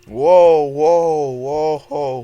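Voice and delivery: loud startled whoas; yelling whoa while crashing; excited whoa. Real, not acted. Reacting to a crash in game.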